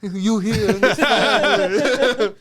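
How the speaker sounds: put-on slow, loud Italian mobster accent. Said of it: "when he did his Italian mobster accent".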